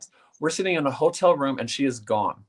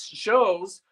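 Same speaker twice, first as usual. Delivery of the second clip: ordinary talk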